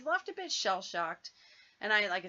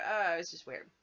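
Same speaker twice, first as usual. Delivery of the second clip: ordinary talk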